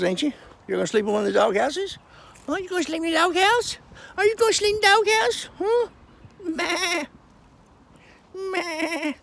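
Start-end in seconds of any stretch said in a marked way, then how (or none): 2.5-5.9 squeaky high voice for the goats; high pet talk. Real, not acted. Teasing goats about doghouse. 6.4-9.1 high bleating meh; goat bleat. Pretend. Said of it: "It's hard to talk like that".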